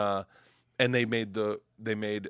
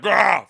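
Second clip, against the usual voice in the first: pained groan; 6 semitones higher, 10 dB louder than usual. Retold or imitated. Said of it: "the guy's like".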